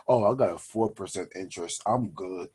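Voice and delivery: low, soft smug brag; bragging. Retold or imitated. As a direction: brush it off like a show-off. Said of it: "someone would brag and be like".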